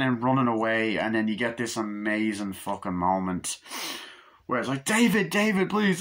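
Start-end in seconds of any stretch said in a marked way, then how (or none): 4.8-6.0 high, raised-voice plea; acted distress; mock desperate pleading. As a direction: imitate a girlfriend begging him to stop. Girlfriend pleads with fleeing David.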